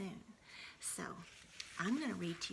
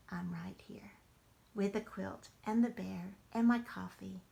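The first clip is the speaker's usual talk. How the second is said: ordinary delivery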